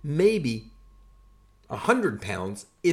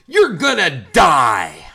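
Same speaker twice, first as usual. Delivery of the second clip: slow, much louder, deadly flat verdict; drill sergeant shout; 4 semitones higher, 12 dB louder, slower than usual. Retold or imitated. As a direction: drop it on him slowly and loud.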